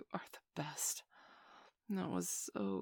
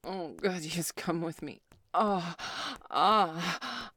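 loud rising moans, higher and louder at the peak; climax moans; 5 semitones higher, 12 dB louder, slower than usual. Acted. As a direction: let go into full loud moans. Acted climax moaning.

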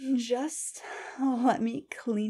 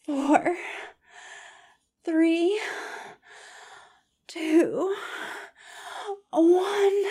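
building countdown; 5 semitones higher than usual. Acted. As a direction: rise in pitch with every number. Building countdown toward climax.

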